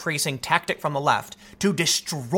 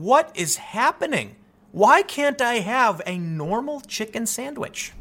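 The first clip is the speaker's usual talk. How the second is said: slow, raised, baffled everyman voice; a lightly put-on baffled middle American; bewildered regular guy; 5 semitones higher, slower than usual. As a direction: play the confused dad staring at a shut store. Bewildered dad at closed restaurant.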